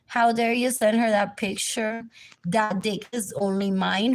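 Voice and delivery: ordinary delivery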